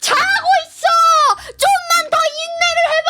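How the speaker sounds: very high, loud cheerleader shouts; self-cheering. Pretend. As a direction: cheer yourself on like a squealing fan. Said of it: "나를 응원하기?". (자기 자신을 응원하며 높은 목소리로 외치는 응원)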